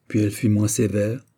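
usual speaking voice

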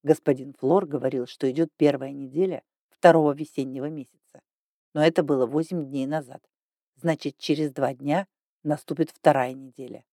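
a hint of a patient old man; calm old ghost. A narrator's reading. Old ghost explains calendar.

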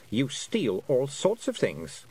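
ordinary delivery, nothing marked

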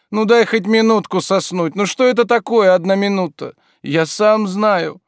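high, loud, exasperated begging; whining plea. A narrator's reading.

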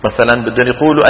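normal talking voice

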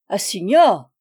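loud, sudden correction; emphatic outburst from a negotiator; exclaimed. A narrator's reading. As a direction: cry out the word in protest. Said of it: "s'écria le négociateur".

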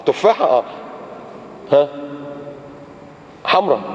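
ordinary talk, nothing marked

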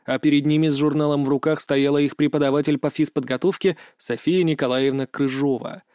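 plain reading voice

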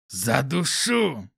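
slow, hoarse, drawn-out threat; strained guard's croak; wheezed threat. A narrator's reading. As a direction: rasp it out while straining. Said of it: "прохрипел страж".

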